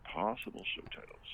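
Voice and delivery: hushed whisper. Acted. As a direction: breathe the words, no voice. Repeats in a whisper.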